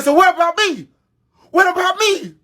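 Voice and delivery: very high, pained pleading repeat; rejected son protesting to his father; hurt outcry. Retold or imitated. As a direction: cry out like a son who was never wanted. Brother answering dad in fight.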